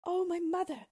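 very high, loud plaintive cry; sad princess. A narrator's reading. Princess answers her weeping mother, sadly, in a high wail. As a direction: cry out high in acted sorrow. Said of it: "replied Bellissima sadly".

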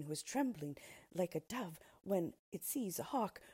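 plain reading voice

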